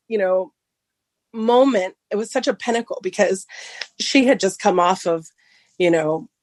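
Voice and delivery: ordinary talk, nothing marked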